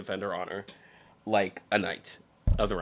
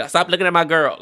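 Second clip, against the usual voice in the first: mock tough boyfriend; 7 semitones higher, 9 dB louder than usual. Acting a protective boyfriend.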